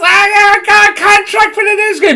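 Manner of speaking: high, fast, gushing mock-celebrity squeal; sing-song bragging in a put-on girly voice; mock Neve Campbell. Vlogger mocking an actress's Instagram post, squealing. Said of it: "she goes on Instagram and goes".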